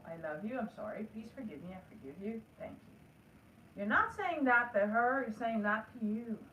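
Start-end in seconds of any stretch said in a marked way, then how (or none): 0.1-2.9 soft, low, gentle mantra; tender self-talk. Shown as a demonstration. Calming herself after anxiety at work, softly tender. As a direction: whisper it like comforting yourself. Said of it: "Now stop and go".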